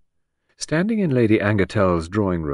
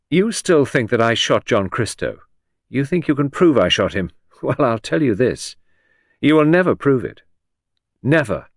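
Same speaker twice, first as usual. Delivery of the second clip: defiant, louder, chin lifted; 5 semitones higher, 5 dB louder than usual. A narrator's reading. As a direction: throw the challenge in his face. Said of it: "She turned on him with her chin lifted".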